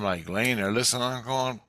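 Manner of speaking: ordinary delivery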